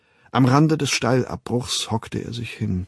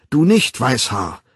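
angry shout; 2 semitones higher, 5 dB louder than usual. Acted. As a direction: bark him away from the line. Men drive the boy away.

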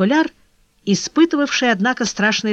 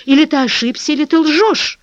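agitated accusation, higher-pitched; crying out; 7 semitones higher, 4 dB louder than usual. A narrator's reading. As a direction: cry out the accusation. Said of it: "вскричал Леон Ролан". (взволнованно, с обвинением)

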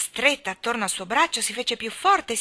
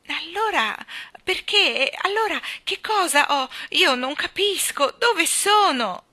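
high, bewildered, rising in volume; terrified child's cry; 8 semitones higher, 5 dB louder than usual. Acted. Jane lost and looking round in terror, crying out. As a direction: panicky questions, high and drawn out at the end. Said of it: "gridò Jane, guardandosi intorno con terrore".

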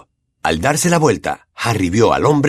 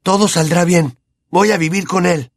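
halting spell; 7 semitones higher than usual. A narrator's reading. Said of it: "dijo entrecortadamente".

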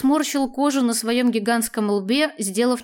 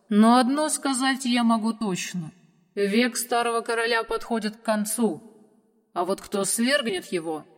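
foretell fate like an ancient dragon. Acted. Dragon prophesies.

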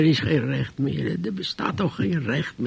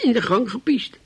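usual speaking voice

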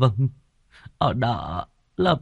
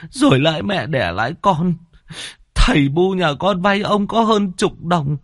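higher, tearful pleading; whimpering plea; 10 semitones higher than usual. Acted. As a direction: beg the landlord near tears. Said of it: "Anh Khoai mếu máo". (mếu máo van xin)

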